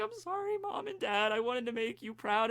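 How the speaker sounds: high, tearful mock apology; crying on the phone. Uraraka apologizes to mom and dad, acted crying. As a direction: squeak it out as if crying. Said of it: "she starts crying on the phone".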